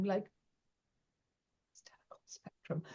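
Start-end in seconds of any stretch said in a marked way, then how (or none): 1.7-2.8 breathy whisper to herself, barely audible; whispered thought. Retold or imitated. To herself after agreeing, a hushed whisper. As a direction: whisper it as a panicked private question. Inner thought during a negotiation.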